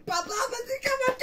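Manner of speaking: high crying yell; crying shout. Real, not acted. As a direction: wail it out high. Kid shouting in background.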